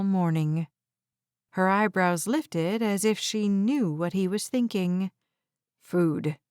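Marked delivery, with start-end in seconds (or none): 5.8-6.4 grunted. A narrator's reading. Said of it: "He practically grunted the word".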